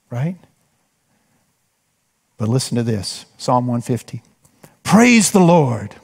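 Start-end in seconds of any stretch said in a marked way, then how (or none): 4.8-5.9 slow, drawn-out exclamation of praise; stretched, emphatic call to worship; exclamatory praise. Shown as a demonstration. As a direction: declare it with an exclamation point. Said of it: "Exclamation point, by the way".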